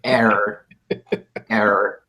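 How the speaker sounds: flat machine error chant; robot voice. Acted. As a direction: repeat it like a broken computer. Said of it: "in a robotic voice".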